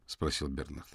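ordinary delivery

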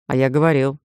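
low, mildly grumpy man's line; grumbling. A narrator's reading. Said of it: "проворчал Хайд".

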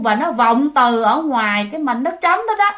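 plain reading voice

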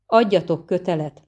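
usual speaking voice

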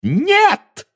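very high squealed no; witch's shrill squeal; shrieking Bellatrix. A narrator's reading. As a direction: shriek in denial. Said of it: "взвизгнула она".

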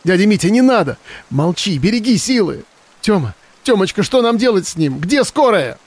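high, desperate crying plea; tearful plea. Acted. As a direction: beg through tears. Girl weeps over dying uncle.

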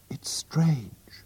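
hushed confiding whisper. A narrator's reading. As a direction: breathe it as a secret. Sister confiding at night.

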